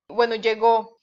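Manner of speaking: ordinary talk, nothing marked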